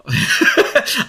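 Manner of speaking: hohes, lautes Auflachen; lautes Lachen. Real, not acted. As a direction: kurz laut loslachen. Lachen über Astrid. (helles Lachen)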